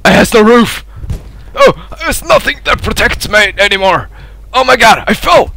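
high, urgent cries; panicked. Real, not acted. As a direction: cry out as the cover goes. Panics as cover collapses.

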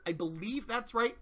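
normal talking voice

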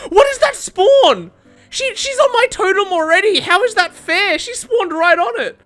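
high-pitched, indignant disbelief; outraged protest. Real, not acted. Gamer protesting someone spawning on his totem, outraged.

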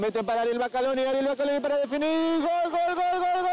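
fast, then very high goal shouts; building to a goal call. Real, not acted. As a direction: speed up and explode into the goal. Attack into goal.

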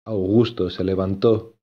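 plain reading voice

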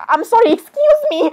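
high-pitched affronted interjection; play-acted indignant squeak; mock indignation. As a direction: break in with pretend offence.

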